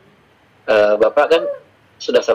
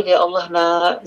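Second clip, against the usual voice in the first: higher, slow, drawn-out despairing plea; exasperated mother; 5 semitones higher, much slower than usual. Retold or imitated. Mother despairs at her grown son's messy room, drawn out. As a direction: let the exasperation stretch the words. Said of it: "saya bilang gitu".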